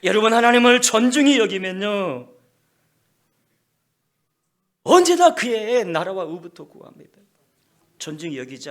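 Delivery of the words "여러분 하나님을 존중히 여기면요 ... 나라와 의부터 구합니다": loud, high preaching voice; full-force sermon delivery; forceful preaching. Acted. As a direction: preach it out to the congregation.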